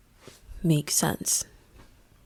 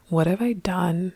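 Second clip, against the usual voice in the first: ordinary talk, nothing marked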